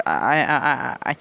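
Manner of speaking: mildly flustered, halting narrated stutter; flustered stammer. A narrator's reading. Girl answers the boy's greeting, flustered stammer. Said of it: "She suddenly started to shudder".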